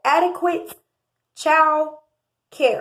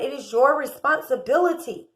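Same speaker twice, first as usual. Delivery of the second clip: ordinary talk, nothing marked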